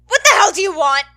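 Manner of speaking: high, very loud scream at the door; furious scream. A narrator's reading. Bakugo screams at the knocker, furious scream. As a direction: scream it at whoever's pounding. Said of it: "he screams at the offender".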